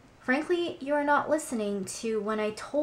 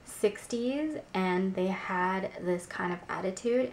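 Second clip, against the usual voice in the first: ordinary talk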